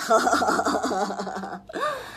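mocking laugh. A narrator's reading. Brothers mock the little pig.

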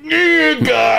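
high strained groan of pain. Retold or imitated. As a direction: groan through a stuck jaw. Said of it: "I'm like".

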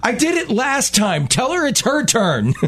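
put-on high, exasperated teen whine; mock a fed-up teenage daughter; snarky daughter. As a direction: mom reading her daughter's snarky line, high and whiny.

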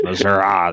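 Missouri said Miz-ur-ah with a broad ah ending; Missoura pronunciation. Shown as a demonstration. Host mocking candidates' rural Missouri, said as Missoura. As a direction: drawl out the ah at the end of Missouri. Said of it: "They get a Southern draw".

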